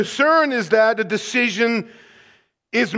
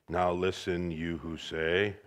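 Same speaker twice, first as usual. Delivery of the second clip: mild low reading without force; flat low reading; 12 dB quieter than usual. Shown as a demonstration. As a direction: read it low and flat, no punch. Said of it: "that doesn't have as much force".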